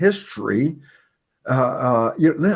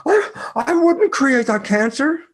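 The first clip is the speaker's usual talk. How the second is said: high, offended denial, pitch jumping up; indignant protest; 11 semitones higher, slower than usual. Retold or imitated. Patients answering the speaker with high, offended denial. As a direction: imitate them bristling at the idea. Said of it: "they look at me and go".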